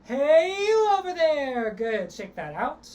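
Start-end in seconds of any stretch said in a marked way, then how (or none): 0.0-1.7 high loud slow call, hey you over there. Shown as a demonstration. Coach demonstrates calling to someone far away. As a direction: call far across a field. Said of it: "Try that".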